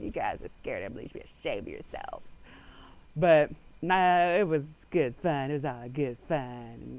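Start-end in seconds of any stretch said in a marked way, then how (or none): 0.0-2.2 low, quiet mock-stern rebuke; scolding pranksters after the scare. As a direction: scold them like a disappointed parent. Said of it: "And I was like".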